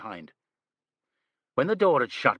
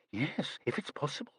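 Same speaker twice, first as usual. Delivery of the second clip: hushed whisper; 5 semitones higher, 9 dB quieter than usual. A narrator's reading. Retold overheard whisper.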